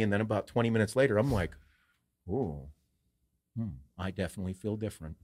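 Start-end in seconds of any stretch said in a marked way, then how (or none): 2.3-5.2 hushed musing. Retold or imitated. Retelling the gummy kicking in.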